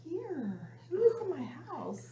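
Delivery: high, hushed alarm; freaked-out retelling. Retold or imitated. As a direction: act spooked, asking softly who is there. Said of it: "freaked me out".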